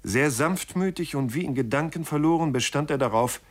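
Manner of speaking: plain reading voice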